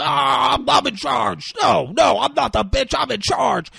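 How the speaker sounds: high, shouted mock protest; insisting on authority. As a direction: yell to take command, voice pitched right up. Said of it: "trying to be like".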